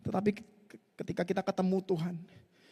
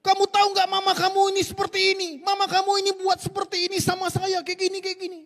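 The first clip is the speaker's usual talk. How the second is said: much higher, heated scolding retold; stepfather's angry rant; 12 semitones higher, 3 dB louder than usual. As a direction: mimic the stepfather's angry outburst. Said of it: "langsung marah, Ibu, langsung maki-maki dia". (meniru ayah tiri yang marah-marah)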